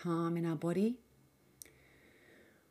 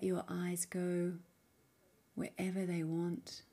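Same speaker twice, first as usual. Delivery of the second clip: soft, unhurried meditation voice with long pauses; guided meditation; 4 dB quieter than usual. Acted. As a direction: gently guide the listener inward, leaving space between phrases. Leads a guided mindfulness practice.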